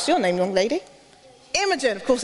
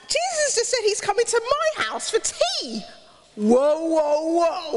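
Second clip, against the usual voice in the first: astonished squeal; 5 semitones higher than usual. Acted. Zacchaeus hearing Jesus call his name, high astonished delight. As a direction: sound like you can't believe your luck.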